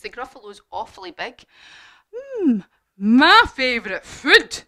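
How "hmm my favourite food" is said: loud, slow, higher monster voice; hungry Gruffalo. Acted. Gruffalo eyeing the little mouse, hungry monster voice. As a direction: savour it slowly and loudly as a hungry monster. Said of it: "the Gruffalo said".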